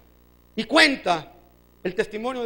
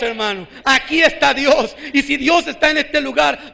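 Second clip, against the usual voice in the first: full-force preaching; 5 dB louder than usual. Acted. Pastor proclaiming to the congregation that God is present, loud preaching. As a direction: thunder it out to the congregation.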